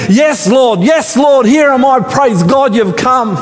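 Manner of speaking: high, eager answer; Abraham eagerly answering God; eager reply. Acted. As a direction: blurt it out with relief. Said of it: "you can imagine".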